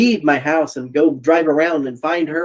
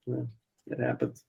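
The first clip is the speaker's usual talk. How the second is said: whispered aside; 12 dB quieter than usual. Acted. Deadpan resigned aside.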